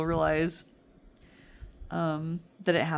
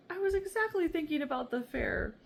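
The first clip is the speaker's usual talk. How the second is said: much higher, amazed; surprised voice; 11 semitones higher than usual. Retold or imitated. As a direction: squeal it like you're caught out. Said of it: "I'm like".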